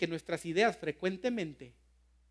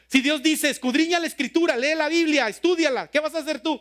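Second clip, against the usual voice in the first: pressing, higher and louder preaching; rising fervor; 8 semitones higher, 8 dB louder than usual. Real, not acted. Pastor pressing the congregation with rising fervor. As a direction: push the question home.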